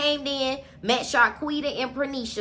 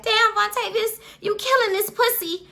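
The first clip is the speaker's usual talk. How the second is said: woman's voice; 9 semitones higher than usual. Retold or imitated. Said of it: "One of his hoes like".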